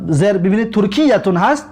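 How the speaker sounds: normal talking voice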